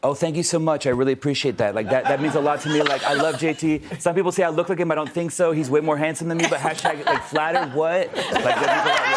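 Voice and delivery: high, gushing pop-star voice; light, high-pitched celebrity humblebrag, bubbling with thanks. Shown as a demonstration. Guest thanking host in character as a flattered star, high J T impression. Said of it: "your J T is exceptional".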